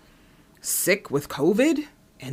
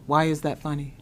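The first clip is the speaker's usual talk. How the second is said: normal talking voice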